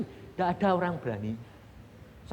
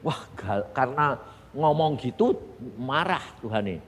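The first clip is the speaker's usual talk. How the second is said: ordinary delivery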